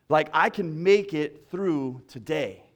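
preaching that swells toward joy; rising sermon close. Acted. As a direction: build to a rejoicing finish. Closing preaching build.

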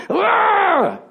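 long high wordless cry of frustration; anguished yell. Retold or imitated. Preacher re-enacting his breakdown before God, anguished cry. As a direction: let out a drawn-out, high wail.

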